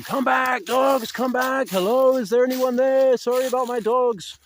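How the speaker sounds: high, loud calling to the dogs. Retold or imitated. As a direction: call the dogs back from far off. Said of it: "I started shouting for them".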